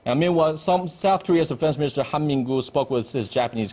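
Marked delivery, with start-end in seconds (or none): none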